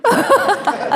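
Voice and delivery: high, teary laugh while apologizing; laughing through tears. Real, not acted. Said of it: "didn't mean to get so emotional".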